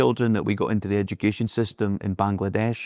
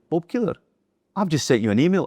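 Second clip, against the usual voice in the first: amazed he is calling her back; surprised recognition; 8 semitones higher, faster than usual. Retold or imitated. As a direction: pitch jumps higher on "Bob Keeler", delighted surprise. Nurse surprised he called back.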